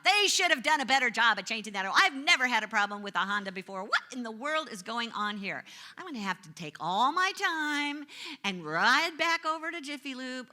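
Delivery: fed-up driver grumbling about a bad oil change, loud and quick; griping complaint. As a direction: rattle off blame at the oil change shop. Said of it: "So my shoulds came out".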